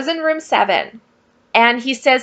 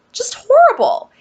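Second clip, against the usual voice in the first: just horrible breathed in a whisper; whispered, much higher and appalled; whispered dismay; 13 semitones higher, 3 dB louder than usual. Real, not acted. Dismay at the new room.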